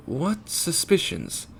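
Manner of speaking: lightly put-on Japanese accent, hint of worry; worried Japanese accent. A narrator's reading. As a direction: draw it out in a mild Japanese accent. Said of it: "questioned with a hint of worry".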